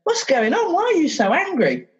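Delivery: gently teasing sympathy; playful concern. Retold or imitated. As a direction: talk to the cupped feeling like a pet. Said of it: "Now I can say".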